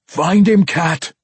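high, urgent shouted plea; loud imploring. Acted. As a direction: implore her at full voice. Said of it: "Bruner implored her".

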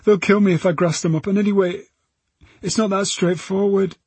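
put-on high, insistent girl's voice; defiant. A narrator's reading. Girl tells police why she won't inform, defiant. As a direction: argue back defiantly, pitched high. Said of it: "Her defiance returned".

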